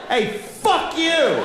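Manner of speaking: high, slow, hammed-up yell; mock yell. Yelling at a stranger in an elevator, hammed-up yell. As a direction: shout it into a stranger's face. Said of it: "turn right to their face and go".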